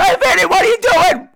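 put-on high, loud Brooklyn Italian accent yelling; hammed-up Brooklyn Italian shout; screaming Brooklyn Italian. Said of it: "sat up screaming in an Italian accent, like a Brooklyn Italian accent".

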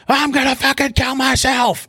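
high-pitched imitation of a ballistic scream; retold screaming. Handcuffed man to officer from back of car, imitated screaming. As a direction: mimic his frantic screamed threat. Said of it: "screaming and everything".

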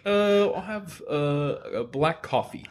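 high, halting mock-nervous order, slow at the end. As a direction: stammer it out like a jittery customer. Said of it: "he was, like, really nervous".

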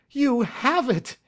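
high, drawn-out cry of joy; joyful gasp. Acted. Banker sees his lost gems, gasping with joy. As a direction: gasp it out high in amazed relief. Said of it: "he gasped".

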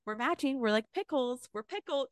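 high, soft, cutesy imitation; mock cutesy Karen. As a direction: coo it like a giddy reality star. Mocking Karen's cutesy pickle talk.

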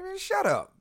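sudden high snap of shut up; cutting her off, mock annoyed. To the friend at dinner, a high mock shut up. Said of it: "I'm like".

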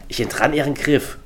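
ordinary delivery

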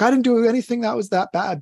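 high-pitched defensive excuse; put-on protest of innocence; mock self-excuse. Voicing a common excuse.

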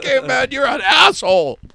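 high, loud crying-out insult; hammed-up yell at Caveman; mock shout.

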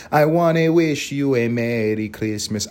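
slow, sung in a hammed-up Spanish accent; mock Spanish accent. Mock-singing the English carol line to listeners in a Spanish accent. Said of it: "doing a Spanish accent with the English part".

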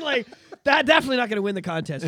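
fast, laughing through words. Real, not acted. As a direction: chuckle while talking it down. Laughing at Tom's song.

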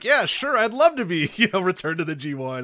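high-pitched imitation of an eager wrestler; eager quoted reply. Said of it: "He was like".

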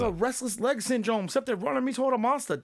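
high, put-on voice complaining about his legs; mock panicked Deku. Host voicing Deku, whose legs run him toward the monster, mock panic. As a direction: ham up helpless legs dragging him into danger.

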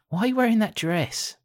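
high-pitched mock questioning; imitating someone querying an outfit; mock objection. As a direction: put on a high voice as an imagined objector. Voicing an objector about the dress.

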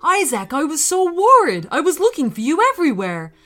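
worried dad. Acted. Worried dad finds son.

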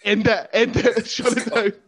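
hammed-up cry to shut it down; mock panic. Host laughing, begging to end the chat.

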